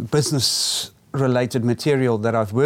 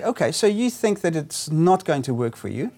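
gentle suggestion; 6 semitones higher than usual. Shown as a demonstration. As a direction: coax the client gently. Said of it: "and then you'd say".

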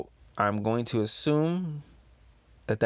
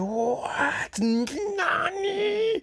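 shocked squeal; much slower than usual. Acted. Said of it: "And then it says".

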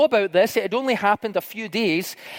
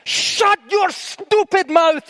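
loud, high bellow imitating the mentor; retold shout; 9 semitones higher, 4 dB louder, slower than usual. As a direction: shout it down the phone, drawing out each word. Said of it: "he shouted on the phone".

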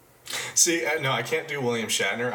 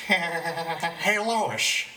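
higher cartoon dad voice; Peter Griffin impression; 5 semitones higher than usual. Podcast host doing Peter Griffin for his cohost, goofy and high. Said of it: "I can do Peter Griffin".

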